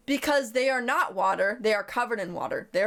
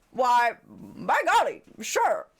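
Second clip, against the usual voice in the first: put-on slow, louder folksy old-timer voice; old-timey folksy man; 3 dB louder, much slower than usual. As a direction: drawl it like a cartoon old codger. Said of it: "And he went".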